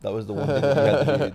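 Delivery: teasing reproach. Real, not acted.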